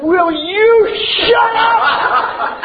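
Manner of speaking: high, very slow mock retort of a fed-up kid; kid talking back. Son turning around on his coach, slow high mock retort. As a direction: drag it out like a boy finally snapping.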